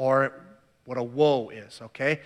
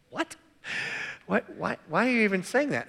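higher-pitched, puzzled questioning; mock bafflement; 4 semitones higher, 4 dB quieter than usual. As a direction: act out a reader's baffled double take. Acts out puzzled reaction to verse.

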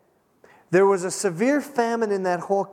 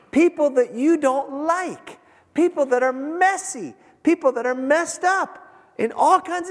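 impassioned preaching; 7 semitones higher than usual. Acted. Preacher pleading with the congregation about God's love, impassioned and high. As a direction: press the point hard on the congregation.

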